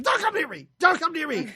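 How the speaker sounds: high, loud mock yell; retold screaming. Podcaster acting out a hurt movie character, mock screaming. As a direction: yelp it out in imitation panic. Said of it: "he's screaming and yelling".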